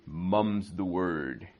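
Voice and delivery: slow, drawn-out British English idiom; British accent. Shown as a demonstration. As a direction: say it slowly like a Brit, savouring each word. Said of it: "in British accent".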